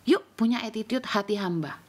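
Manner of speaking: ordinary talk, nothing marked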